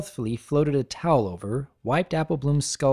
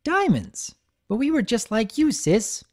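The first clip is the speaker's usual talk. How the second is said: grinning high filly voice; 9 semitones higher than usual. Acted. Sweetie Belle continues.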